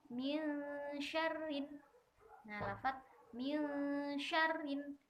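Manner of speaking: ar-rijal with a light, doubled r, very slow; thin doubled r, slow. Shown as a demonstration. Teacher reciting thin r examples slowly. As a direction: min syarrin with a thin, held double r. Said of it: "maka harus dibaca tipis".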